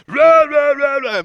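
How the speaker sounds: high loud mock yelling rah rah; imitating screaming boss. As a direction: bark it like a furious boss. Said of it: "He loved screaming at people".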